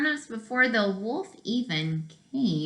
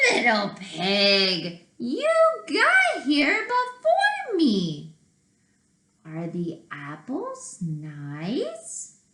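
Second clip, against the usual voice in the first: sly wolf; 2 semitones higher, slower than usual. Acted. Wolf greets the pig in the apple tree, slow and sly. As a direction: fake friendliness at the tree.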